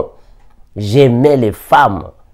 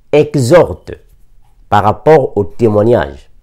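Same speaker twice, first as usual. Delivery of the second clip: ordinary delivery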